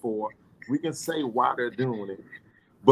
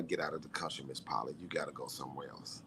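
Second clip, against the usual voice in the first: much lower and softer, trailing off; dropping to a low murmur; 10 semitones lower, 16 dB quieter than usual. Real, not acted. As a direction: mutter it away under the breath. Fading aside to Miss Polly.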